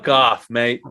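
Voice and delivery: put-on Australian accent. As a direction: tell them off in an Australian accent. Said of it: "that's when my Australian comes out".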